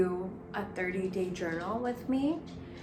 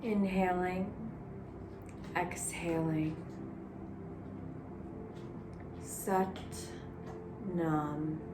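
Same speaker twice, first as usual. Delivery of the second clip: guided meditation. Acted. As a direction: gently guide the breath. Leads a Sat Nam meditation.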